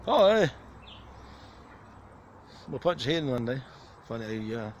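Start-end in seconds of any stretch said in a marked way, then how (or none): 0.1-0.5 loud high call to a bird. Real, not acted. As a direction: shout a quick hey across the bush. Calling out to a bird.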